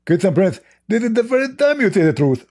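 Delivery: high, mocking put-on voice; mock commenter voice. Reading out a commenter's boast to mock him, in a high put-on voice. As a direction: parrot the commenter in a silly high voice.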